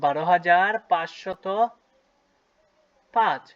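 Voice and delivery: বারো হাজার পাঁচশত drawn out word by word; teacher spelling out the number aloud; slow teaching read. Shown as a demonstration. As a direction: say it slowly for the class. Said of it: "উচ্চারণটা কি হলো?".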